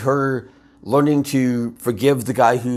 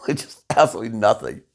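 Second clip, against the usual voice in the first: ordinary talk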